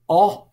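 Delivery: "O," said as the short o of rotten; vowel as in rotten. Shown as a demonstration. Teacher models the short o for students, sounding it out. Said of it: "as in rotten".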